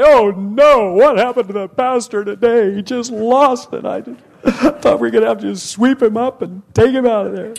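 high, loud, laughing gossip; mock gossiping congregant. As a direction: play a shocked churchgoer, hammed up. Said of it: "people are gonna say".

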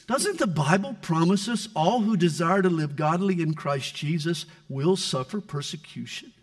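ordinary talk, nothing marked

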